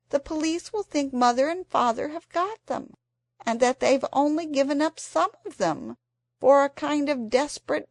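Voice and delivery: put-on fretting child pleading with siblings; anxious girl. A narrator's reading. Anxious Anthea fears the police will blame parents.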